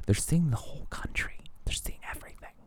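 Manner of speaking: breathless awed whisper. Retold or imitated. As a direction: whisper it in wonder. Awed imitation, trailing into whisper.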